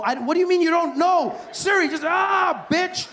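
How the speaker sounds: high, loud mock tantrum; hammed-up screams of frustration; exasperated outburst. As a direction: blow up at the phone assistant. Losing temper with phone assistant.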